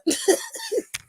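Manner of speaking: real high-pitched giggle; a burst of high laughter at her own joke. Real, not acted. Vlogger laughing at her own comeback, high giggle.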